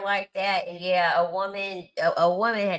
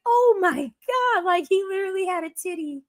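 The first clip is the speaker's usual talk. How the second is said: much higher, squeaky disbelief; shocked squeal; 13 semitones higher than usual. Retold or imitated. Telling viewers about a patient's chest, high shocked squeal.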